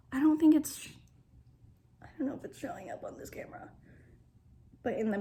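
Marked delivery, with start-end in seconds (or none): 2.0-3.7 whisper. Real, not acted. Fast whispered aside.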